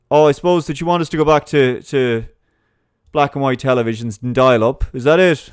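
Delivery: sarcastic imitation of a smug scold; mock sneer. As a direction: talk down to them scornfully. Mocking a sneering progressive.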